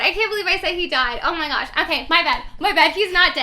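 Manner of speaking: real flustered, loud self-correction; very excited. Real, not acted. Storyteller correcting her blunder to listeners, excited and flustered. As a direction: blurt the apology fast and loud. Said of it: "very excited".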